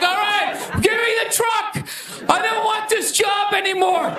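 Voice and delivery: high, pleading mock-panic cry; panicked surrender. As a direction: beg to be let off the job, half-yelling. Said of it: "on the ground crying in the fetal position".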